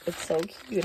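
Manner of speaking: ordinary delivery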